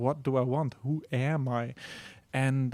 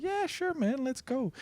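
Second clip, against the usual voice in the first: much higher, easygoing dude voice; laid-back synth voice; 11 semitones higher, 3 dB quieter than usual. Pretend. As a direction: toss it off like a relaxed surfer buddy. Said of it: "It's like".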